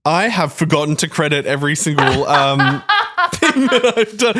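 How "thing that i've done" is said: high giggle through the words; giggling embarrassed confession; laughing. Real, not acted. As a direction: laugh while admitting it. Laughing at own omission.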